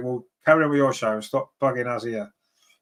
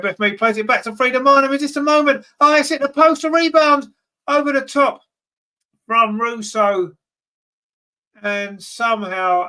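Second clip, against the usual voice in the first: excited commentary; 9 semitones higher, 5 dB louder than usual. Real, not acted. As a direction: voice leaping higher and louder as the shot hits the post. Near miss, ball hits the post.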